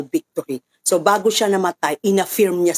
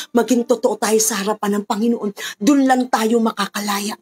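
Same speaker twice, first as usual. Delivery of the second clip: prayer; 9 semitones higher than usual. Acted. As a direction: pray aloud for the whole congregation, rising in fervour. Pastor continues prayer over music. (taimtim na panalangin na lumalakas at tumataas)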